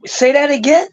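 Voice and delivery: high-pitched mock disbelief; sarcastic. Real, not acted. Co-host needles the questioner about a tired topic, sarcastic. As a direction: sarcastically feign not having heard. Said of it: "I'm being sarcastic".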